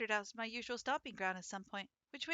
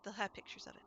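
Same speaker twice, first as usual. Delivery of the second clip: whispered aside; 5 semitones higher, 4 dB quieter than usual. Real, not acted. Excited aside about bird cafes.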